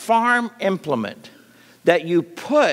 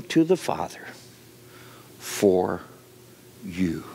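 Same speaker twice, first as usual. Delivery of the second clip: soft reassurance sinking into a whisper; gentle Jesus fading to a whisper; 4 semitones lower, 3 dB quieter than usual. Acted. Preacher voicing Jesus to the church, hushing to a whisper. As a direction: lean in and let it drop to a breath.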